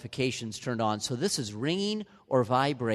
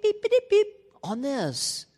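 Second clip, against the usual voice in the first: high chirpy beep-beep imitation; mock phone beeps; 11 semitones higher, 4 dB louder than usual. Pastor mimicking a phone's nagging beeps, high chirpy.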